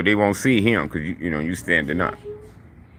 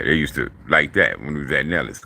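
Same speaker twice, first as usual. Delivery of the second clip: ordinary delivery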